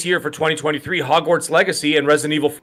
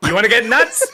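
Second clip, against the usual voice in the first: laughing movie quote; 5 semitones higher, 3 dB louder than usual. Acted. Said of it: "What movie was that?".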